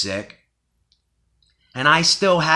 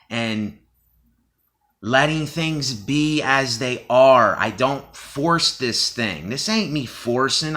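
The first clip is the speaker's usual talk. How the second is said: ordinary delivery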